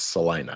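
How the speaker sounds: "Salina" as sa-LYE-na, the middle like "lie"; sa-LYE-na for "Salina", said like a local; Texan Salina. Shown as a demonstration. Co-host insisting on the Texan "Salina", sa-LYE-na. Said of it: "You wanna sound like a Texan".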